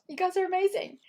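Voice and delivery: high, loud enthusiastic praise; excited praise. Real, not acted. Coach telling her students they're amazing, high and excited. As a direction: cheer the group on.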